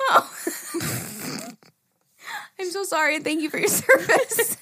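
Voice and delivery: high, laughing sorry; real giggles while thanking her for her service; laughing apology. Real, not acted. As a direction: keep laughing through the apology. Laughing at her own mistake.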